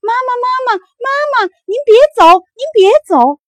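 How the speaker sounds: put-on children running after their mother, crying out for her to stay; desperate shouting. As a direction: call out as she flies away, pleading. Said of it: "一边追一边喊".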